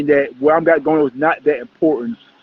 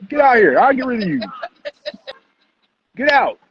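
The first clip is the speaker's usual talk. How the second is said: dismissing; 8 semitones higher, faster than usual. Real, not acted. Host throwing out an uninvited guest.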